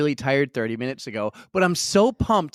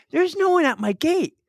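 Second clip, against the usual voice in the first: surprised; 13 semitones higher than usual. Real, not acted. Surprised at empty gate.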